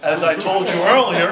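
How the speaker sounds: loud, laughing aside to the audience; laughing through the reminder. Real, not acted. Laughing while telling the crowd.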